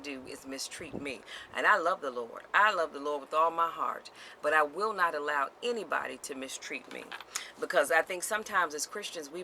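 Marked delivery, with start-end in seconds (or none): none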